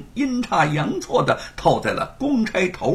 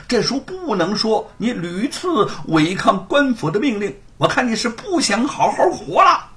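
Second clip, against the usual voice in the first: constable shouting the storyteller down, higher and louder; angry shout; 6 semitones higher, 3 dB louder than usual. A narrator's reading. Constable threatening the storyteller: angry shout. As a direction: bellow a furious warning. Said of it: "大声喝道".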